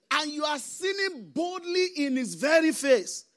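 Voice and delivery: high, loud preaching cry; forceful warning. Real, not acted. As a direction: drive the rebuke home to the congregation. Preacher warns sinners at full force.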